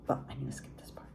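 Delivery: breathy quick whisper, soft; whispered aside. Real, not acted. Reader skipping a passage for her audience, whispered. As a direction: hush it, hurry past.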